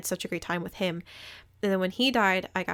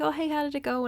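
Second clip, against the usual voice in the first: cheery friend voice; 8 semitones higher, faster than usual. Retold or imitated. Friend's cheery question retold.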